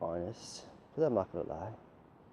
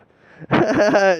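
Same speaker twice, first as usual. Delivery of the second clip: very loud, high burst of laughter; 12 semitones higher, 18 dB louder than usual. Real, not acted. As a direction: explode into laughter.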